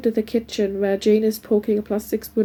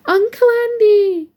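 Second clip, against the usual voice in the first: put-on high, loud, drawn-out child's cry; excited child's greeting; 12 semitones higher, 6 dB louder than usual. A narrator's reading. Nearly four-year-old godson greeting his uncle, excited high shout. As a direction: call out in delight like a small child. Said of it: "It's greeted".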